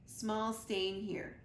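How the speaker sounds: plain reading voice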